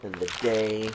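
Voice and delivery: calm, even read-aloud voice; steady storybook telling; story narration. A narrator's reading. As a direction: read the story gently to the kids. Reads picture book aloud.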